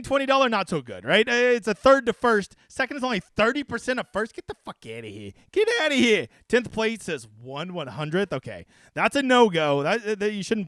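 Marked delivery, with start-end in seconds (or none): 4.3-5.3 low, soft disgusted dismissal; disgusted mutter. Real, not acted. Host scoffing at a bad payout, low disgusted aside. 5.5-6.3 higher, louder outraged scoff. Real, not acted. Host scoffing at a bad payout, loud outraged.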